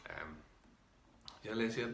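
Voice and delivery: usual speaking voice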